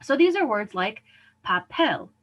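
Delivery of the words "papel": "papel" as pa-PEL; last-syllable stress. Shown as a demonstration. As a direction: land on the final syllable of "papel". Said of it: "emphasizes on the last syllable".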